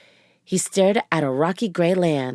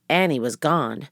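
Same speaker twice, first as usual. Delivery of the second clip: ordinary delivery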